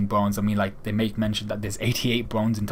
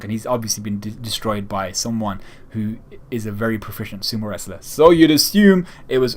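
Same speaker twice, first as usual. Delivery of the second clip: ordinary talk, nothing marked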